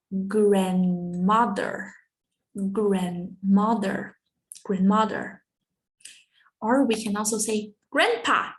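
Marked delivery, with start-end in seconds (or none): none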